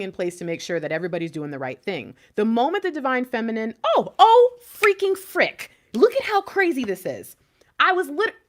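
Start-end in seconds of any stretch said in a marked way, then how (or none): 3.8-8.3 loud, high-pitched startled outburst; freaking out. Real, not acted. Reader startled by the cards mid-sentence, freaking out. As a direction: burst out in real astonishment. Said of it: "why I'm freaking out".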